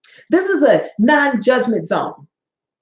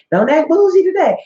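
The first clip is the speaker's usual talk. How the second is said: repeated higher and louder, a playful scold; 6 semitones higher, 4 dB louder than usual. Real, not acted. Speaker teasing audience about dish soap, rising playful scold. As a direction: push it up, teasing the crowd.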